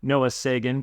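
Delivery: ordinary talk, nothing marked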